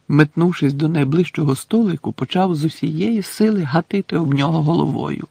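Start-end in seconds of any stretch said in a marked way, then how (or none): none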